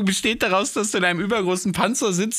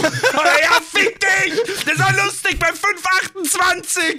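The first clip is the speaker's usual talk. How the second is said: hammed-up angry outburst; mock rant; 11 semitones higher, slower than usual. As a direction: snap back at the commenter in fake fury. Imagined reply to a rude commenter.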